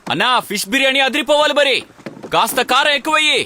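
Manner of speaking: loud, high-pitched food order; calling out. Acted. Customer ordering fish biryani, calling out loudly. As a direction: holler the order across to the cook.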